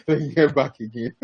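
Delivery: slow words through laughter; laughing. Real, not acted. Laughing through own work routine.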